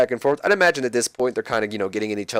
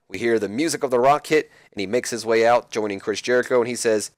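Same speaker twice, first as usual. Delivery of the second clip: ordinary delivery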